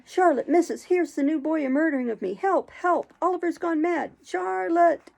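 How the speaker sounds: high, loud panicked cries; shouting for help. A narrator's reading. Noah shouting for Charlotte and the missus, panicked. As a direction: scream for rescue.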